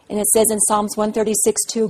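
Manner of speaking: ordinary talk, nothing marked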